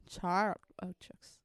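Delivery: whispered, slow Sharp Objects; whispering. Shown as a demonstration. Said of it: "series de nombres que pueda pronunciar".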